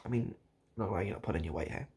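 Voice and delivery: ordinary delivery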